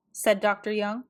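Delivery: ordinary delivery, nothing marked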